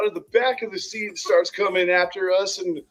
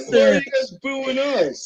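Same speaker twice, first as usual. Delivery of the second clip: mock innocent protest; 9 semitones higher than usual. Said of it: "We'd be like".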